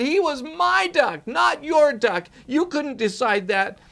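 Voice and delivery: put-on high, loud child's tantrum yelling. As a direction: rage at Dad, out of control. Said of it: "He was out of control".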